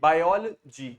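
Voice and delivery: "biology" broken as bai-ol, then a separate jee; loud "biology", split off the final "jee"; separated syllable. Shown as a demonstration. Teacher asks student to say jee separately, segmented pronunciation. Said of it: "आप जी को अलग बोलिए".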